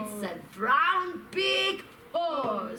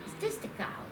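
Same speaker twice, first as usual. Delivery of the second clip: whispering; 4 semitones higher, 10 dB quieter, much faster than usual. Acted. Pointing at the toy cow.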